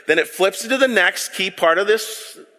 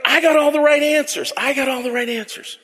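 mock know-it-all; 7 semitones higher than usual. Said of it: "I see him kinda like this guy".

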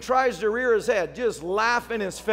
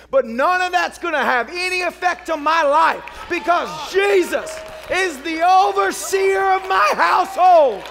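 preaching at full force; 6 semitones higher, 7 dB louder than usual. Acted. Pastor rallies his church against witchcraft, shouting it out. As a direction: preach it out over the congregation with full conviction.

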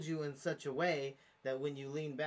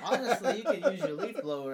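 laughing; 6 semitones higher, 11 dB louder than usual. Real, not acted. Laughing about leaf blower.

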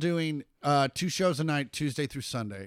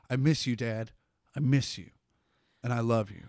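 heartfelt line; 6 semitones lower than usual. Shown as a demonstration. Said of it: "like, supposed to be like".

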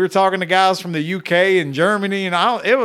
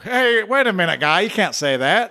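imitating Canadian fans; 5 semitones higher than usual. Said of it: "they're like".

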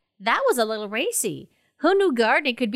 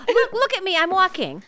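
eager reassurance; 7 semitones higher than usual. Acted. Eager to show compliance.

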